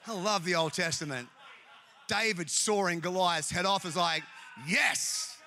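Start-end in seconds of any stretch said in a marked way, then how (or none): 4.7-5.4 sudden high, punched "yes"; exultant cheer. Acted. Preacher relishing David sawing off Goliath's head, exultant cheer. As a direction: cheer it like a fan. Acting delight at Goliath's beheading.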